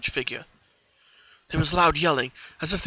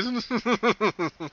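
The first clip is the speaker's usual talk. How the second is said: ominous chuckle; 8 semitones higher than usual. Acted. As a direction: chuckle like a gloating villain. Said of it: "The colt chuckled ominously".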